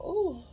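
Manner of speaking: a high, weary ooh that breaks out; a worn-out ooh before admitting she's tired. Real, not acted. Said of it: "I'm so tired".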